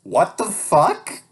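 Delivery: very high, loud and slow squeal of shock; can't believe what you wrote; real shock breaking into a shriek; stunned disbelief. Real, not acted. Shocked by own childhood book.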